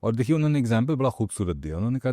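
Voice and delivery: ordinary talk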